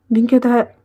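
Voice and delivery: ordinary delivery, nothing marked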